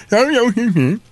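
high, garbled British impression; hammed-up deaf British accent; mock deaf British accent. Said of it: "deaf British accent".

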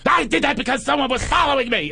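high-pitched campy protest; frantic queeny excuse, hammed up; Paul Lynde. Friend pleading with the cop, mock queeny outburst. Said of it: "He's turning into Paul Lynde on steroids".

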